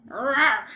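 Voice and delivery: short growled rawr; bear growl rawr. Acted. Bear answering the old lady, growled rawr. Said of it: "growled Old Bear".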